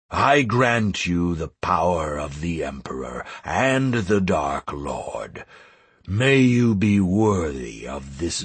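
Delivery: low, measured, majestic dragon voice; slow, ceremonial pronouncement in a low register; solemn great dragon bestowing titles. Acted. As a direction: proclaim it as an ancient dragon, weighing each phrase.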